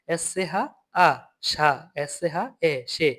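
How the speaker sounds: slow, letter by letter; slow spelling drill. Shown as a demonstration. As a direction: spell out each sound slowly. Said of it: "এভাবে আপনারা বাকিগুলো পড়বেন". (ধীরে ধীরে বানান করে উচ্চারণ)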